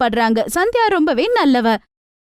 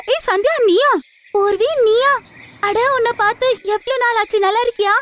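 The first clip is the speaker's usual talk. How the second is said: delighted friend; 7 semitones higher than usual. Acted. Friend meets old friend.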